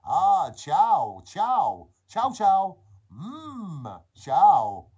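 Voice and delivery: loud, hammed-up Italian accent. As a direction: belt out each word as an over-the-top Italian. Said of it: "we all have an Italian accent".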